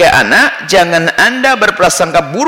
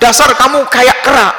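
mock insult; 7 semitones higher, 3 dB louder than usual. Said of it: "Misalnya apa?".

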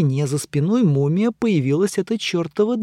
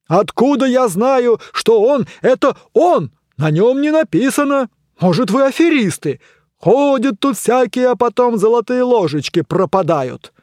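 indignant voice; 8 semitones higher, 5 dB louder than usual. A narrator's reading. The door arguing back.

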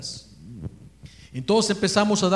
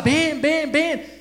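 calling out; 9 semitones higher, much slower than usual. Retold or imitated. Calling up to woman on balcony.

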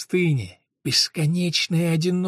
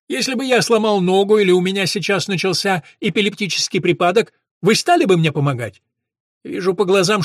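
higher, mocking scholar's voice; sneering professor; 4 semitones higher, 5 dB louder than usual. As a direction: taunt him like a cornered professor. (насмешливый голос профессора)

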